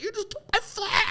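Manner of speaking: very high, strained squeal; mock outrage. Golfer confronts man who took his flag, squealed mock outrage. Acting out his outburst at theft.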